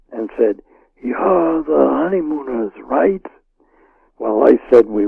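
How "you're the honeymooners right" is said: softer, slow hoarse gangster whisper. Retold or imitated. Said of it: "sort of a hoarse whisper".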